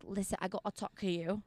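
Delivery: put-on soft, muddled Boston accent. Prankster pulling a priest from his chat, in a muddled Boston accent. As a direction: attempt a silly Boston accent, hushed. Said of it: "start speaking Spanish in a Boston accent".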